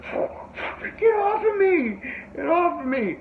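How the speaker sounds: high, drawn-out panicky cry; panicked yell. Retold or imitated. Kid with gummy stuck to his hand, panicked yelling. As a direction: shriek like it's stuck to you for good. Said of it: "He's like".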